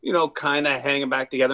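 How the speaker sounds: ordinary delivery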